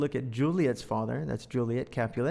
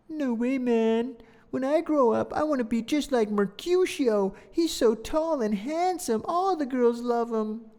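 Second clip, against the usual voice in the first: put-on much higher, louder child voice; excited little kid; 11 semitones higher, 4 dB louder than usual. Little fan gushing about Benvolio in a kid voice. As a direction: squeak like an eager small boy. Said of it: "this kid's probably saying".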